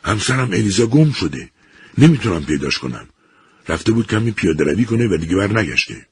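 a husband bursting in with bad news; husband telling his colleague his wife is missing; urgent alarm. A narrator's reading. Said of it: "ایزمانی تند گفت".

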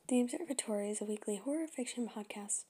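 whispered outro read; whispered podcast sign-off; whispered credits. Shown as a demonstration. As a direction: breathe the credits right into the mic.

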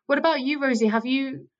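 ordinary delivery

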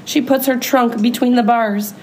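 ordinary delivery

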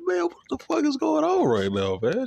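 high, stunned, half-laughing disbelief; reacting in shock, voice leaping up. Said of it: "I'm like".

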